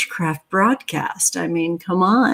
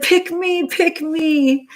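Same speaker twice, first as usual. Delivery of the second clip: much higher, pleading pick me; eager volunteer; 9 semitones higher, slower than usual. Retold or imitated. Retelling how she begged to join the offering, high and eager. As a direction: bounce up like a kid begging to be chosen. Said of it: "like I'm known to do, said".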